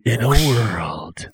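slow whispered trailer voice. Pretend. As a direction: breathe it like a movie trailer. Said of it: "[name], you're narrator".

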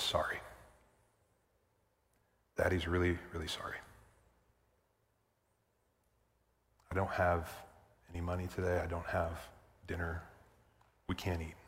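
put-on soft, low, broken apology to his kids; a hint of tears in a hungry family's dad; ashamed father. Broken father voiced in story.

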